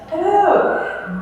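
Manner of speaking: bright laughing high hello. Real, not acted. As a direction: greet them with a laugh. Greeting visitors, laugh heard.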